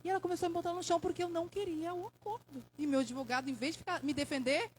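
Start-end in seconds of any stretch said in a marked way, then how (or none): none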